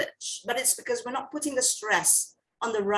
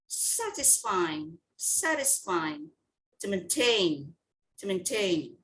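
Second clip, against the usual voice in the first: satisfying and To maintain, each repeated low and drawn out; stress demonstration; 5 semitones lower, 3 dB quieter, slower than usual. Shown as a demonstration. Teacher gives class the stress answers, stress demonstration. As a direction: model satisfying and To maintain slowly, stressed syllable clear. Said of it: "Mark the stress on the words".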